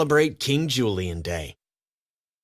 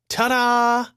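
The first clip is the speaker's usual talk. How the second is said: high, loud grand entrance; triumphant royal ta-da; showy king voice; 12 semitones higher, 4 dB louder than usual. Acted. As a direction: burst in like a vain king. King bursts in announcing himself.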